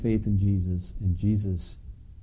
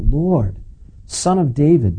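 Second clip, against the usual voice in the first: loud, pitched-up plea to the Lord; crying out; 5 semitones higher, 6 dB louder, slower than usual. Acted. A Canaanite mother crying out to Jesus, loud plea. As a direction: call out to him, raised and desperate. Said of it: "crying out".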